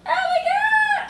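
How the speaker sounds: high, loud, drawn-out squeal; excited revelation. Real, not acted. Speaker struck as something clicks, loud squealed exclamation. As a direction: cry it out, stretched high and loud.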